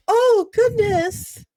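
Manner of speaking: high, loud, slow flustered gasp; put-on breathy girlish oh goodness; flustered ditzy girl. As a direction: play the clumsy innocent. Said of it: "I'd be like".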